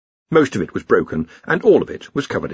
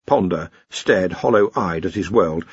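ordinary delivery

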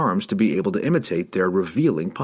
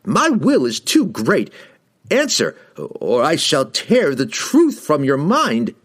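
loud, high, slow wizard roar; roaring threat; 8 semitones higher, 5 dB louder, slower than usual. A narrator's reading. Wizard threatens to tear out the truth, roaring. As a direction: threaten with full imperious force. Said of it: "Kessel roared".

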